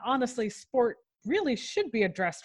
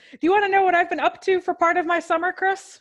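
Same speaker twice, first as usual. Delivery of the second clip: radio host voice; 7 semitones higher, 4 dB louder than usual. Pretend. Continues the mock radio banter.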